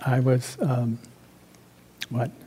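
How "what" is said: normal talking voice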